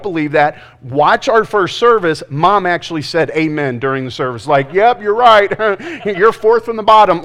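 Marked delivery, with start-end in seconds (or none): 4.7-7.2 called out high and loud; mock yell from the pews; mom yelling out. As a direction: shout it across the room like mom. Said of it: "she yelled it out".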